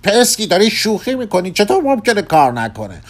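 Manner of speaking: high, loud cry of disbelief; alarmed shout. A narrator's reading. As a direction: shout at someone in exasperated panic. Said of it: "فریاد کشید".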